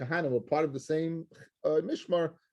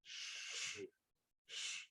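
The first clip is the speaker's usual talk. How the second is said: whispered shushing; the mother silencing her child with a whispered shh; hushing shh; 22 dB quieter than usual. Retold or imitated. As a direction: hush the child with a breathy shh. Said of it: "and the mother goes".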